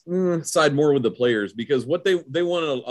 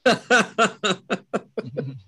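hearty laugh; 10 semitones higher than usual. Real, not acted. Amused by the dancing joke.

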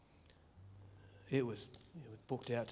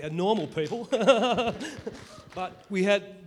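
laughing; 6 semitones higher, 10 dB louder than usual. Real, not acted. Laughing about family being normal.

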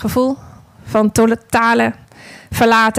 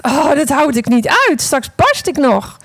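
anxious thought; 6 semitones higher, 3 dB louder than usual. Acted. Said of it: "En ik dacht".

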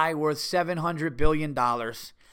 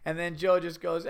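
ordinary talk

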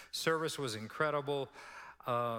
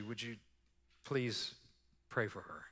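soft, half-whispered plea, much softer; hushed request; 5 semitones lower, 6 dB quieter than usual. Retold or imitated. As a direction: ask it under your breath so she won't hear. Quietly asks preacher to pray.

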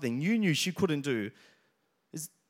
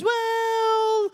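very high, drawn-out doubtful sing-song; skeptical wife; 6 dB louder than usual. Retold or imitated. Wife doubting her husband's claim, exaggerated and skeptical. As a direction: stretch it high and loud, eye-roll in it. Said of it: "she rolls her eyes and she goes".

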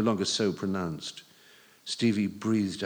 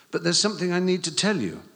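higher, fast and louder; urgent boy voice; 9 semitones higher, 5 dB louder, faster than usual. A narrator's reading. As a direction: rush it out like a nervous teen.

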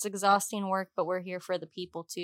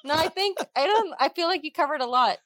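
laughing; 8 semitones higher, 5 dB louder than usual. Real, not acted. Laughs off question.

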